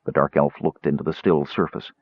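plain reading voice

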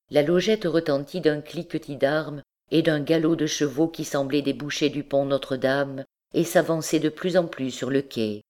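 usual speaking voice

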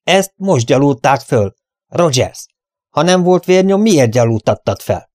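loud, high indignant challenge; heated cross-examination; accusing outburst. A narrator's reading. Furious accusation at discovered evidence.

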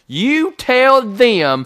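slow, high, loud shout; retold angry order. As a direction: bellow each word slowly, reenacting the order. Retelling his angry order to wife.

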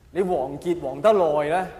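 ordinary talk, nothing marked

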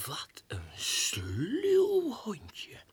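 sly whisper. Acted. Grudging remark after dog leaves.

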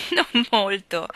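slow words through a light laugh. A narrator's reading. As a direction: laugh it off warmly. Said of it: "rise Anna".

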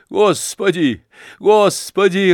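high loud cries of alarm; crying out. Acted. Onlookers crying out at the burning money. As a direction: gasp it out as the crowd panics. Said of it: "раздавалось кругом".

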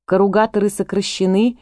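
ordinary delivery, nothing marked

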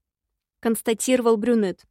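plain reading voice

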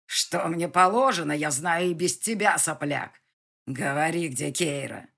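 put-on loud furious growl at the boy; growling rage. A narrator's reading. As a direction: roar it in fury. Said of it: "прорычал Алекс".

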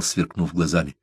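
usual speaking voice

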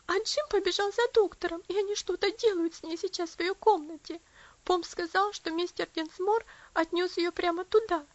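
high loud agitated telling; agitated child. A narrator's reading. Lucy tells her mother about Elsie, agitated, high and loud. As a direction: rush it out to mother.

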